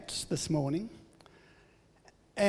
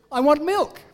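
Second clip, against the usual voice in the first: put-on higher, louder insistent little girl; demanding child; 8 semitones higher, 7 dB louder than usual. Grandfather voicing granddaughter insisting on milk, higher and louder. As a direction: repeat the demand like a stubborn toddler.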